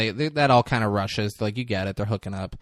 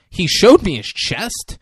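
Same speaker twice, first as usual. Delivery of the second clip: loud, slow, higher accusing line; mock accusation; 8 semitones higher, 6 dB louder than usual. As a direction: spell it out slowly at him. Reese accusing dad.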